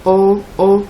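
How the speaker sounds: "en" repeated as a lone first syllable. Shown as a demonstration. Teacher matching ambulance to ampoule, repeating the syllable. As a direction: say "en, en" by itself to find the match. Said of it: "On peut répéter la première syllabe".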